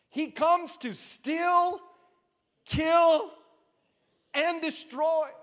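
slow, high, building preacher's cry; fiery sermon reading of scripture; preaching scripture. Acted. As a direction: proclaim each word like a warning. Preaching the scripture at full force.

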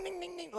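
high, soft ding ding ding; tiny high-pitched dings; mimicked tool dings. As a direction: chirp it like a little machine. Imitating nail tool sound.

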